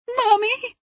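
put-on very high, frightened child's cry; broken, terrified. A narrator's reading. Daughter calls out to her mother on the phone, terrified. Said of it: "her voice broken and terrified".

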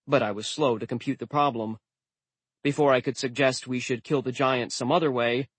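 ordinary delivery, nothing marked

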